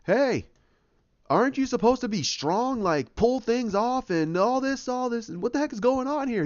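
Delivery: high-pitched indignant nagging, imitated; Rocket. Rocket to pinned Banner Hulk, high acted indignation. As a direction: pitch it up like an exasperated sidekick. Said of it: "you have Rocket going".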